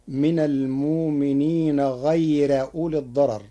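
غيرَ with the ra thin and voweled a; light ra with a. Shown as a demonstration. Teacher reciting the Warsh form, غيرَ with a thin fatha ra. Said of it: "بنصب الراء مع الترقيق".

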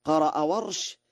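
ordinary talk

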